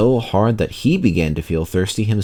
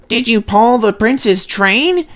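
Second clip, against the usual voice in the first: much higher, slow, eager engine voice; Peter Sam; 14 semitones higher, 4 dB louder, slower than usual. A narrator's reading. Peter Sam asking Sir Handel, high and slow. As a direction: ask it like an awed little engine. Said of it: "he asked Sir Handel".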